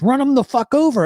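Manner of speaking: high, loud urgent yell; yelled order. Retold or imitated. Accomplice yelling at the driver to run him over, loud and high. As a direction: bark it like a panicked accomplice.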